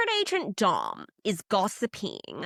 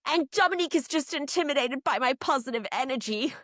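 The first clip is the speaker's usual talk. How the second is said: quoting Olivier; 5 semitones higher than usual. Retold or imitated. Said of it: "He said".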